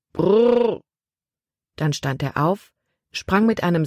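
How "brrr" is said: calling horses to halt. A narrator's reading. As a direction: rein in the team with a call. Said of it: "rief er".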